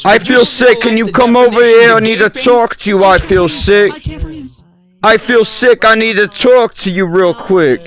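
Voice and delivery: hammed-up sick whine; whiny sick voice. Playing a sick voice.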